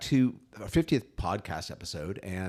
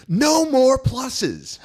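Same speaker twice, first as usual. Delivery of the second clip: much higher and louder, long drawn-out words; mock outburst yell; 14 semitones higher, 9 dB louder, much slower than usual. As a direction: yell it out of nowhere, stretching every word. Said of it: "just yells".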